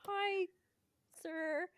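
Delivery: high, tentative greeting; timid fan greeting. Retold or imitated. Fan greeting a park character, high and hesitant. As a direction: imitate a fan's hesitant, high-pitched hello.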